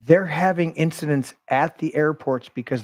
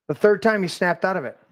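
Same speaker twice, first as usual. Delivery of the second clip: ordinary talk, nothing marked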